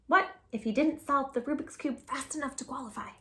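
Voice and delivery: put-on reading a picture book aloud to kids; gentle storytime narration; storybook narration. A narrator's reading. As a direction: tell it like a read-aloud story for children.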